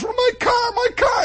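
high, pleading my car, my car; high, despairing wail; wailing owner. Retold or imitated. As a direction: cry out for your wrecked car. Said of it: "and the guy is".

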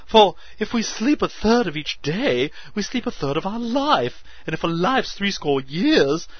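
high loud frantic joy; hysterical excitement. A narrator's reading. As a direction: burst with manic excitement. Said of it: "excitement to his hysteria".

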